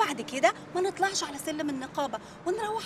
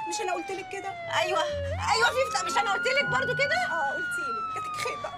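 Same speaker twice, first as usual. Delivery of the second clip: much higher comic squabble; squabbling. Acted. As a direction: bicker shrilly as they drag you. Bickering while being arrested.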